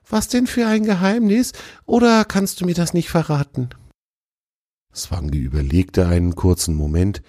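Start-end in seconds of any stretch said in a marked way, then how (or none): 0.1-3.7 put-on much higher young deer calling out; calling fawn. A narrator's reading. The fawn calls after the fox who walks past, high call. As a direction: call out to a friend, high and bright. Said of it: "sagte Tjawe".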